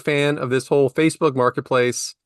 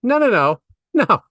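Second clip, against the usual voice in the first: mock panic; 6 semitones higher, much slower than usual. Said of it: "I'm like".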